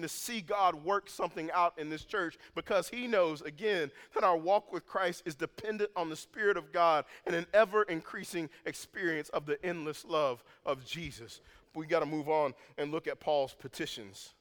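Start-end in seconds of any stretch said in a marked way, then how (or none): none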